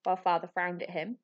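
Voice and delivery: plain reading voice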